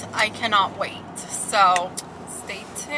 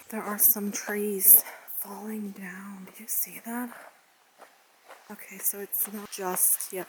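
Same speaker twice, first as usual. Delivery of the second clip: whisper; 11 dB quieter than usual. Real, not acted. Spotting falling trees on trail.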